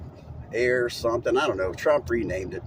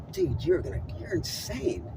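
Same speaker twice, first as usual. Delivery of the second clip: incredulous; 8 semitones higher, 5 dB quieter than usual. Retold or imitated. Reacting to 190 mph claim.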